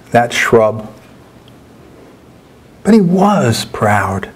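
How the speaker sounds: measured, lingering poem reading with long pauses; poetry reading. Acted. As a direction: let each image land slowly. Reads his poem aloud.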